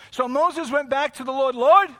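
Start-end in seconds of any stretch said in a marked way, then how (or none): 1.6-1.9 loud, high cry to God; raised voice. Acted. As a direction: call out sharply in acted complaint. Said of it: "let me not raise my voice".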